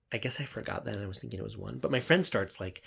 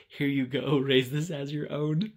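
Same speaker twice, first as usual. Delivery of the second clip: slow, hammed-up ceremonious handover; a son grandly handing over an egg; mock solemn offering; 4 semitones higher, 5 dB louder than usual. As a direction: present the egg like a grand gift, slowly.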